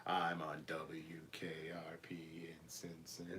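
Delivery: whispered theme line. Pretend. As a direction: whisper it softly.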